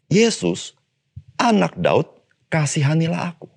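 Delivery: high pleading call to Jesus; crying out. Retold or imitated. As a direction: cry it out like a desperate blind beggar. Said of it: "orang buta itu langsung berseru".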